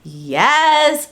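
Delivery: loud eager yes; excited. Real, not acted. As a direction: burst out with excitement. Excited about the book.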